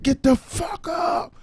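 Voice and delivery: high, drawn-out mock yell; imitating himself getting nasty on mic; nasty outburst. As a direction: stretch it into a high-pitched cussing cry. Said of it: "I'm like ... I get nasty".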